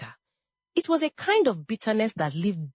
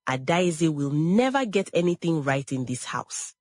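ordinary delivery

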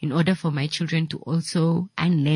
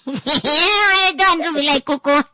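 laughing through the words, pitched up; laughing off her son's jab, higher; 10 semitones higher, 3 dB louder than usual. Real, not acted. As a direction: giggle it out in mock protest.

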